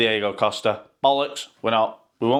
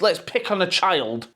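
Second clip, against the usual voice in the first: ordinary talk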